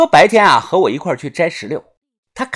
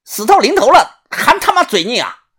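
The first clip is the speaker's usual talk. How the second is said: mocking shout; 8 semitones higher, 5 dB louder than usual. Captor mocks defiant prisoner.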